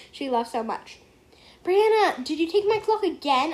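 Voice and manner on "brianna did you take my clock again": louder, higher call to her sister; yelled. Acted. Girl yells at her little sister about the stolen clock. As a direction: yell at her across the room. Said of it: "I yelled".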